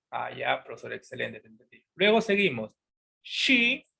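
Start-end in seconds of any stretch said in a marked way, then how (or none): 0.1-1.8 low voice fading to a murmur; student's quiet reply. Pretend. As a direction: play the pupil catching on, low and soft. Teacher voices an understanding student. (respuesta de alumno, baja, apagándose)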